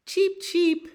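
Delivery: high, loud chirpy cheeps; sparrow cheep. A narrator's reading. Reading the sparrows to a child, high cheeping.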